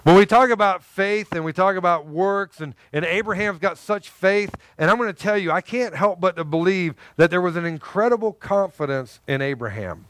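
ordinary talk

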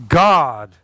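slow, low and steady assurance; calm reassuring Abraham. Retold or imitated. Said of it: "when he told his son".

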